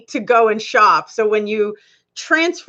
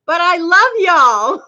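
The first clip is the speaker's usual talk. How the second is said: put-on playful Southern accent; 7 semitones higher, 5 dB louder, slower than usual. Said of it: "'cause I say "y'all".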